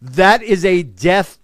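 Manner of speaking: slow, loud verdict; slow emphatic. Real, not acted. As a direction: land it slowly like a sentence.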